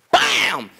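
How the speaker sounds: falling "bam" whack; club whack bam. Acted. Bear whacking the hollow log, punchy bam. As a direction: imitate a club striking wood.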